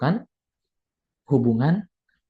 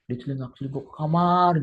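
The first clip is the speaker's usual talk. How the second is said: ordinary talk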